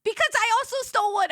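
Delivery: high, loud and fast; guilty giddy confession. Retold or imitated. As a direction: blurt it out, half laughing. Sister's confession.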